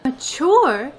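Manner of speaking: loud, offended echo of the word; indignant surprise. Acted. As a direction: throw the word back, stung. Offended at being called mature.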